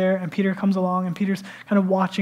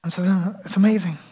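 normal talking voice

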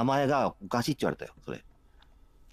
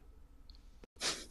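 whispered repeat, much softer; 18 dB quieter than usual. Real, not acted. Repeating it to the streamer in a soft whisper. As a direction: breathe the line out as a whispered aside. Repeats the same line twice.

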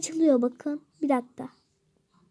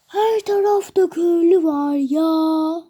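louder, slow, put-on exclamation of the same line; loud mock exclaim; 8 dB louder, slower than usual. Gamer telling viewers villagers are everywhere, loud silly exclaim. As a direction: belt it out in a silly voice.

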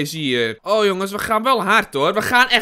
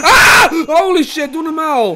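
loud high scream into protest; startled shout; 8 semitones higher, 9 dB louder than usual. Real, not acted. Player scared right after pulling lever, startled shouting. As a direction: shout in real shock.

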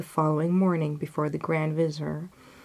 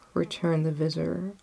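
plain reading voice

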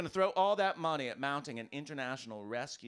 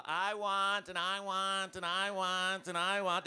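whiny, higher demanding voice; demanding American; 5 semitones higher, 3 dB louder, slower than usual. Pretend. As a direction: play the spoiled American demanding more. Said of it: "Very demanding people".

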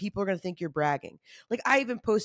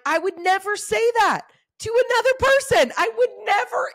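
much higher, louder indignant cry; indignant outcry; 14 semitones higher, 6 dB louder than usual. Real, not acted. Insisting to listeners she'd never say that, loud and high. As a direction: cry out in disbelief.

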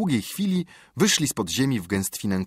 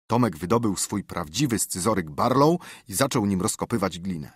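ordinary delivery, nothing marked

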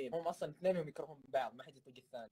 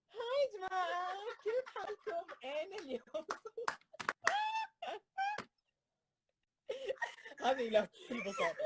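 exaggerated camera voice; 3 dB quieter than usual. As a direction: gush at the camera in a high voice. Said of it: "قدام الكاميرا نبرة صوتي تتغير".

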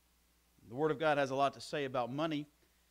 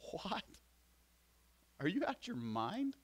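mock outrage; 4 semitones higher, 3 dB quieter than usual. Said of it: "No, I'm just kidding".

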